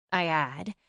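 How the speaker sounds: plain reading voice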